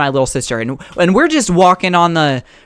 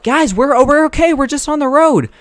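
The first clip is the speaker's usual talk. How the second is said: calling back; 9 semitones higher than usual. Retold or imitated.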